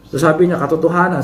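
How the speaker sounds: ordinary talk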